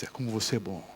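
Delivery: tempting devil voice. Acted. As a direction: purr it low like the tempter. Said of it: "Aí vem aquela voz". (voz baixa e sedutora do diabo)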